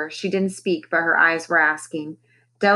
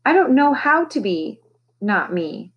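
raspy voice; 6 semitones higher than usual. A narrator's reading. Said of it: "she rasped".

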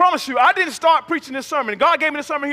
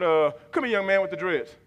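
usual speaking voice

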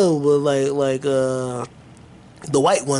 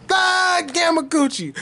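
excited shout; 14 semitones higher, slower than usual. Real, not acted. Inventing a silly band name.

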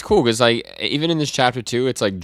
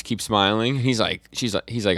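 normal talking voice

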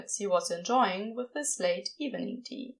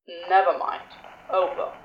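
Crowley voice; 5 semitones lower, 3 dB louder than usual. Acted.

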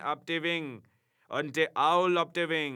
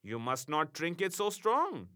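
put-on soothing German-accented imp, slower; softened, consoling; 7 semitones higher than usual. A narrator's reading. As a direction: gently coax him to stop crying. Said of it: "much softened at my distress".

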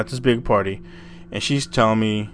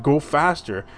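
usual speaking voice

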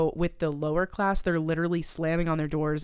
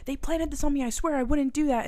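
much higher, pleading protest; mock pleading; 10 semitones higher, 3 dB louder than usual. Jack protesting to the officers, high mock plea. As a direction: squeak out a frantic denial.

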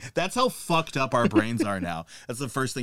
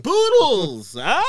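very high, loud gleeful squeal; hammed-up delighted yelp; mock ecstatic cry; 9 semitones higher, 8 dB louder, much slower than usual. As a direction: shriek with joy over poodles.

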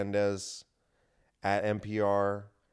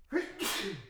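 sneeze; 11 semitones higher, 5 dB quieter than usual. Real, not acted. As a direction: let out a sharp sneeze. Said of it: "I'm gonna sneeze".

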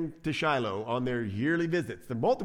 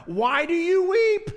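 very high, loud, drawn-out question; hammed-up, squealed husbandly bafflement; mock exasperated husband; 9 dB louder, slower than usual. Elkanah to his weeping wife, mock exasperation.

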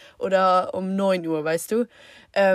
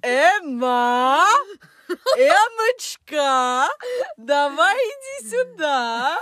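high, loud, laughing mock call; mocking mum's tone; 8 semitones higher, 4 dB louder, much slower than usual. Mum calling daughter to kitchen, hammed-up high call. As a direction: ham up mum's sing-song calling. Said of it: "Und dann auch in so einem Ton".

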